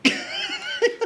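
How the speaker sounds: high burst of laughter. Real, not acted. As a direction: crack up laughing. Laughing at joke.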